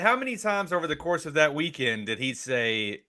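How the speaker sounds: usual speaking voice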